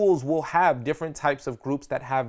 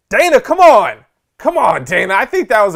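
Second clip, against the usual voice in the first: playful protest; 7 semitones higher, 13 dB louder than usual. Real, not acted. Teasing audience member.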